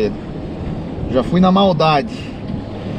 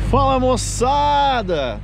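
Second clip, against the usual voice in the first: vlog greeting; 9 semitones higher, much slower than usual. Real, not acted.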